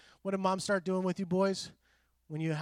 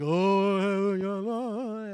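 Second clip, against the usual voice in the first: mock flowery singer; 6 dB louder, much slower than usual. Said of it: "I don't talk like that".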